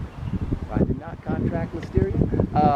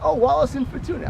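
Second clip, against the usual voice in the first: ordinary talk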